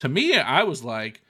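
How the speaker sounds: ordinary delivery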